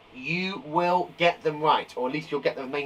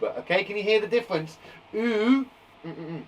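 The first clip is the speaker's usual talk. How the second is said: usual speaking voice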